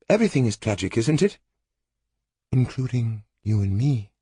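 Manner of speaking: lightly voiced sorrowful woman; a hint of resigned grief; Lorinda, rueful. A narrator's reading.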